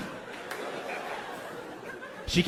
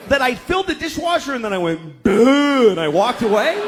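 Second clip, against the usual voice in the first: pitched-up exasperated plea to his wife; 5 semitones higher than usual. Retold or imitated. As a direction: beg her to let you be dumb. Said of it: "And I'm like".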